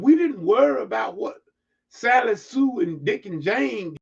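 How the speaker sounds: rising sermon fervor, some lines slowed; preaching. Real, not acted. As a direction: preach it up to the congregation. Preaching at full force.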